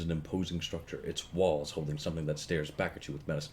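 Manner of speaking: calm, measured storytelling voice; story narration. A narrator's reading. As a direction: read it like a novel narrator. Reads game narration.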